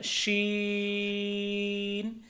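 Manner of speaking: शीन with a long, level ee; greatly lengthened. Shown as a demonstration. Teacher shows learners the length, शीन stretched out. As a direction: sustain the ee in शीन before the n. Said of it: "तीन से पाँच अलिफ के बराबर".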